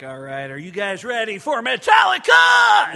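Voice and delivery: high, very loud arena hype; hype shout. Retold or imitated. Comedian hands the stage to Metallica, shouting hype. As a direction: whip the crowd up for the band. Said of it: "and I was like".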